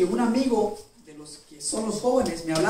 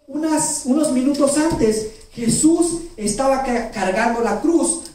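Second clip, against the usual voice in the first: slower, lifted and louder sermon; slow preaching emphasis; 4 semitones higher, 4 dB louder, slower than usual. Acted. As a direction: stress the before and after of the cross slowly. Predicador enfatiza el contraste. (predicación lenta y enfática)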